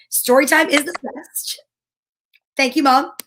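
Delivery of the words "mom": ordinary delivery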